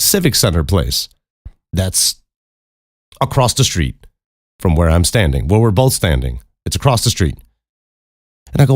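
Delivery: ordinary delivery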